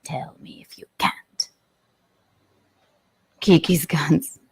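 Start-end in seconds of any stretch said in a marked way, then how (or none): 0.0-1.5 soft breathy whisper. Acted. Host teasing viewers about her audio, whispering. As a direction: whisper it like a secret.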